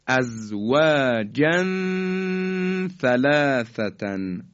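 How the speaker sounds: slow recitation of «ازواجن ثلاثتن», hummed tanwin; measured «ازواجن ثلاثتن» with a long nasal link; ikhfa. Shown as a demonstration. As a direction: stretch the last n of «ازواجن» into «ثلاثتن». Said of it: "این طرز اخفای".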